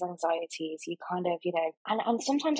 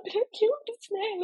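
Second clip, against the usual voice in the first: tearful plea; 12 semitones higher than usual. Retold or imitated. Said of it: "I don't think I've cried like that".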